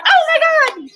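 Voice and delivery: high, loud surprised squeal; excited gasp. Retold or imitated. Teacher recalling hearing Mike Myers on TV, squealing. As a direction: burst out in delighted recognition. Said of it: "and I was like".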